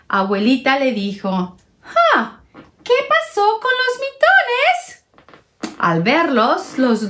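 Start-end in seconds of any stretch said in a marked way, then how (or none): 1.8-5.0 very high, puzzled granny voice; a grandmother wondering aloud; lightly voiced curious grandma; grandma asking. Grandma asks about mittens.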